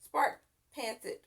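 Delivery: usual speaking voice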